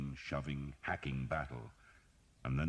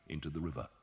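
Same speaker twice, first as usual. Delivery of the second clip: usual speaking voice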